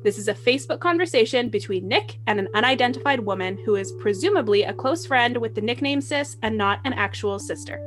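ordinary talk, nothing marked

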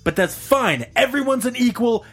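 high-pitched indignant speech; impassioned defence. Retold or imitated. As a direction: imitate a bystander earnestly lecturing a bully. Acts out bystander's impassioned defence.

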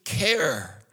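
very high-pitched, desperate protest to Jesus; anguished plea. Acted. Preacher voices parents' anguished plea.

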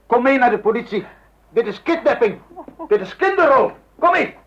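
high, loud, rising fury; furious command. Acted. Husband angrily orders his wife to come to the police. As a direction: order her out, getting angrier.

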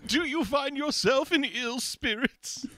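put-on high, fussy old-timey fop; hammy stage actor. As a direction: play a prissy dandy. Fop character courting.

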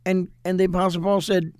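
usual speaking voice

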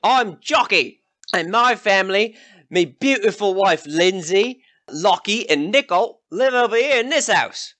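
high, pushy yokel calling out; brash rival villager. Acted. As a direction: shout down a rival host. Rival villager Jockey hails travellers.

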